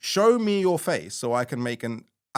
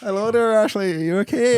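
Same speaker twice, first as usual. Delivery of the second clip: high, loud mock phone-agent lilt; Orla; 12 semitones higher, 9 dB louder than usual. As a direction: greet the caller in a squeaky put-on voice. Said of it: "we'll just put you through to Orla".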